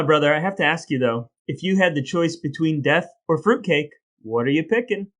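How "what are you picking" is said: usual speaking voice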